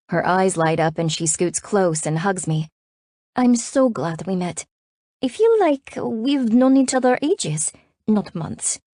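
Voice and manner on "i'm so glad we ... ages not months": put-on slow, high-pitched, affectionate French-accented voice; heartfelt French friend. A narrator's reading. French friend after a hug, heartfelt. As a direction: take it slowly, full of affection, French-accented.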